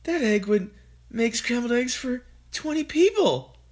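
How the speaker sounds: high, amazed mother; mother's voice. A narrator's reading. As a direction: marvel as the mother. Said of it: "Missus Cranky said".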